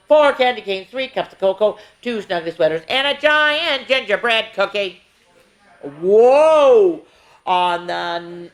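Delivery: lively sing-song counting rhyme; reading to children. Acted. Miss Tasha reading a Christmas counting book to kids, sing-song. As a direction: read it out brightly, verse by verse for the little ones.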